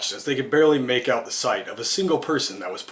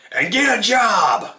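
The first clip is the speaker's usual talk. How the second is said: high, drawn-out parting holler; farmer's shout; 9 semitones higher, 4 dB louder, slower than usual. A narrator's reading. Farmer yelling at the departing animals' backs, shouted. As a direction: shout it slowly after them, pitched up. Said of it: "the farmer shouted at their backs".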